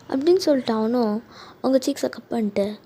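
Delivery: ordinary delivery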